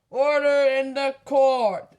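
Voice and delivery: loud, slow gavel shout; judge's shout. Acted. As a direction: bellow for order. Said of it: "Doc shouted".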